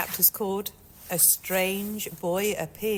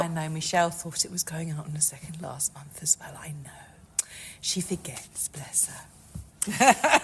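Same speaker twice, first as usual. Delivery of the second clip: voiceless conspiratorial whisper; 4 dB louder than usual. Real, not acted. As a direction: whisper it like gossip behind her back. Gossiping aside about colleague.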